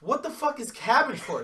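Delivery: loud, high indignant question; outraged question. Retold or imitated. Told by her uncle it is cabbage, a loud high indignant question. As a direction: demand it in disbelief.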